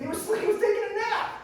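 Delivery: high, loud outburst of disbelief; incredulous yell. Acted. Preacher reacting to Jesus asleep in the storm, incredulous yell. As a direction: cry it out as if it's absurd.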